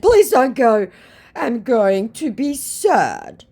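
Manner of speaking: whiny hammed-up begging; mock pleading scammer. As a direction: scammer begging her to stay, high and slow. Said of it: "he hurriedly said".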